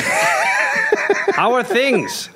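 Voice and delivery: slowly stretched through laughter; drawn out and laughing. Pretend. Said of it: "I just said".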